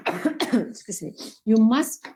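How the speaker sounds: normal talking voice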